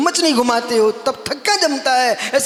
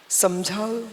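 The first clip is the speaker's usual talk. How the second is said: ordinary talk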